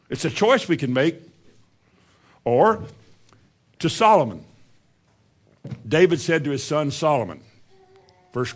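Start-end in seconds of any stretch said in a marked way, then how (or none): none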